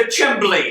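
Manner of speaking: chimbley, said with a b instead of chimney. Real, not acted. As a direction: reads chimbley as written.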